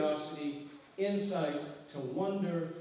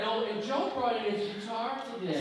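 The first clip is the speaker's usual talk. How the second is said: higher, dreamy mock-gushing girlfriend; 6 semitones higher than usual. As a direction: mimic her swooning over Joe, teasing. Imitating girlfriend's gushing about Joe.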